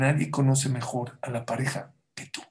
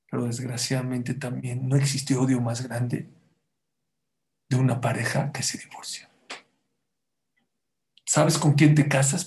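ordinary delivery

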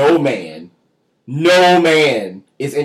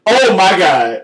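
disgusted exclamation; 4 semitones higher, 7 dB louder, slower than usual. Real, not acted. Recoiling at the gremlin face.

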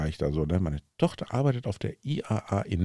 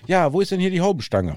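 imitating daughter; 9 semitones higher, 4 dB louder than usual. Tochter fragt am Telefon.

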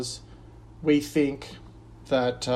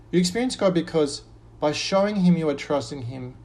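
ordinary talk

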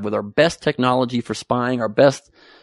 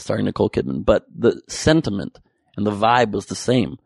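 usual speaking voice

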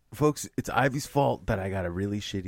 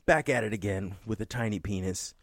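ordinary talk, nothing marked

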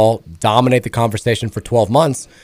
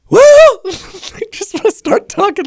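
cracking voice; 5 dB louder than usual. Shown as a demonstration. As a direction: voice cracks high and breaks. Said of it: "it cracks, it breaks".

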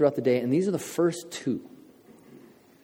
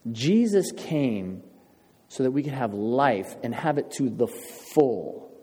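slowed key line; slower than usual. Real, not acted. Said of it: "key verse, slowed".

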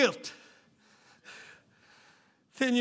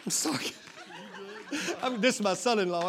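laughing apology; 9 dB quieter than usual. Real, not acted. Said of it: "I'm spitting".

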